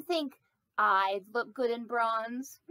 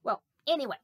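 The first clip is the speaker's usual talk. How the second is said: ordinary delivery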